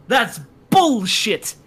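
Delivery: slow, loud, emphatic retort; imitated indignant outburst, drawn out; indignant customer. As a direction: spit it out as the outraged customer.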